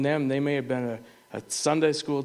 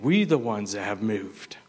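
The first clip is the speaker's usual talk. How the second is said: usual speaking voice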